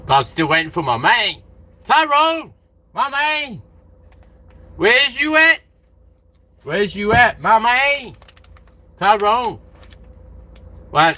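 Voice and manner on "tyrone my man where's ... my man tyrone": loud high greeting call. Pretend. Calling his friend Tyrone over with a loud high shout. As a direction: holler for your buddy.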